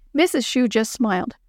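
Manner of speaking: ordinary delivery, nothing marked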